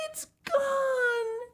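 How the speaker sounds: high, near-crying wail; sad cry. A narrator's reading. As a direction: wail in dismay, almost crying. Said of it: "she said sadly".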